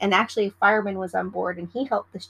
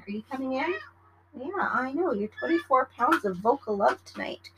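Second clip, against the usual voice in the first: higher, softer pet-talk voice; cooing pet voice for her cat; 4 semitones higher, 4 dB quieter than usual. Real, not acted. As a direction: coo at the cat coming in.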